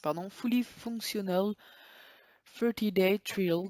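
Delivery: slow, careful English with a French accent. Real, not acted. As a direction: spell out the English words slowly in a French accent. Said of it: "Désolé pour mon anglais qui est pas très terrible". (anglais lent avec l'accent français)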